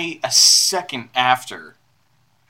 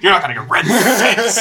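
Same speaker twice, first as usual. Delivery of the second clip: griping spouse; 7 semitones higher, 6 dB louder than usual. Acted.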